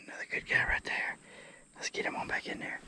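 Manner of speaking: soft whispered aside. Real, not acted. Releasing a fish back into the water, whispering. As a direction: hush it so only the mic hears.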